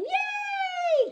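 high happy squeal; cheer. Acted. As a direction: squeal with joy for the kids. Cheer after the song.

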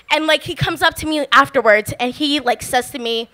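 normal talking voice

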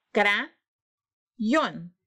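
"Crayón" as two syllables with a long pause between; split into syllables. Shown as a demonstration. Teacher separating a word for pupils, "Crayón" split into syllables. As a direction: break "Crayón" after the first syllable, lifting it, then drop on the second. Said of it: "separar la palabra crayón en sílabas".

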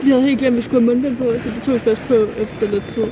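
muffled behind cloth mask; speaking masked; through a face mask. Real, not acted.

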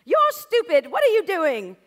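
shrieked very high and loud in an English teacher's voice; harsh teacher. Retold or imitated. Teacher scolding the student's drawing, shrill shout. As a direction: screech the insult down at the student. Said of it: "She did have an English accent".

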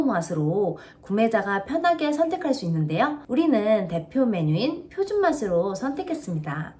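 광고 문구 읽듯 또박또박; 홈쇼핑 내레이션처럼 설명하기; product ad read. Acted. (시청자에게 세 가지 양념 맛을 소개하는 광고 낭독)

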